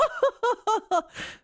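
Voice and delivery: high haughty noble-lady laugh; ojou-sama laugh. Pretend. A smug ojou-sama laugh closing the mock scolding. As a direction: finish with a smug villainess cackle. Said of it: "that laugh".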